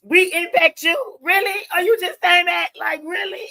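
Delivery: high, loud retold surprise; amazed disbelief. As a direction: act out astonished viewers who can't believe it. Retelling viewers' amazed reaction.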